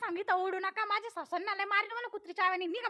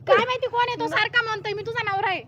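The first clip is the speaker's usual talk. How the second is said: loud, heated outburst; indignant complaint; 9 dB louder than usual. Acted. Woman complains to husband about drunk stranger, loud indignation. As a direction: loudly report the intruder, outraged.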